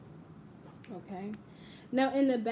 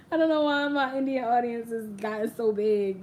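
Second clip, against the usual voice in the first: loud laughing disbelief; 7 dB louder than usual. Real, not acted. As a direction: laugh through the words. Laughing about big audience.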